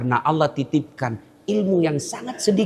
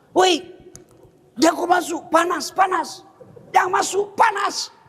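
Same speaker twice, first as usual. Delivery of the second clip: shouted warning; 12 semitones higher than usual. Retold or imitated. Warning man at oven door.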